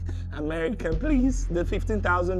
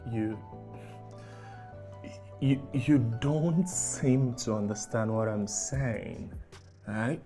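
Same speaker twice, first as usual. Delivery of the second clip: pleading softly; 8 semitones lower, 8 dB quieter than usual. Acted. Scammer pleading on phone softly.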